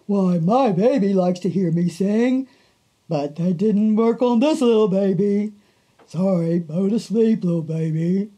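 a hint of a proud donkey offering help; donkey's voice. Donkey tells animals he'll sing to the baby, lightly voiced. Donkey apologizing after failed song.

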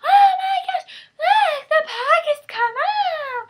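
over-the-top marketer. Pretend. Mocking her own marketer act with a squealing pitch. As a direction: gush at the pockets like a giddy TV seller. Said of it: "What kind of talent marketer talks like this?".

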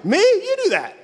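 very high, loud mock indignation; defensive comeback. Preacher giving it back to his corrector, indignant comeback. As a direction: fling the blame straight back, squeaking upward. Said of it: "give it back to 'em".

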